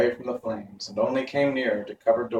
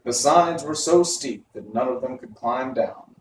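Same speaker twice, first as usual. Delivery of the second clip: ordinary delivery, nothing marked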